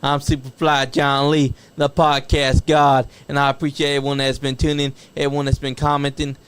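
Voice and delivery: loud hype podcast sign-off; hype announcer. Acted. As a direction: pump up the crowd for next week. Loud hype outro for the show.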